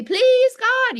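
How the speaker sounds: high, loud pleading wail; mock begging. Imitating someone pleading with God in a high, loud wail. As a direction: beg God like a desperate person. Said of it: "It doesn't say beg".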